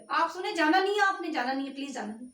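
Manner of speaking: loud plea. Real, not acted. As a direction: call out urgently so nobody leaves. Pleading with viewers to stay. (ऊँची आवाज़ में दर्शकों से रुकने की गुज़ारिश)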